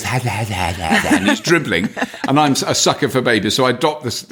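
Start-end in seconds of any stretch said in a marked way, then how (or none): 0.0-1.2 imitating baby giggle. Baby at the reception desk reaching up, giggling. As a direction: copy a dribbly baby's laugh. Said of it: "puts his little arms out and goes".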